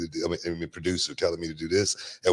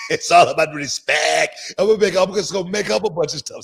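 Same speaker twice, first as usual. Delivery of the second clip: hyped-up promo; 10 semitones higher, 5 dB louder than usual. Retold or imitated. Acting out his promo attitude.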